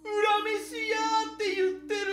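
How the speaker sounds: frightened. Acted. Scared man sees a ghost.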